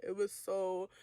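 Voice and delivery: ordinary delivery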